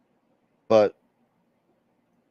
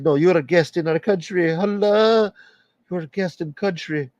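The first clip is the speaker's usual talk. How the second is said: rough imitation of an Indian accent, higher; amateur Indian accent, pitched up; 7 semitones higher than usual. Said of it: "a Indian accent".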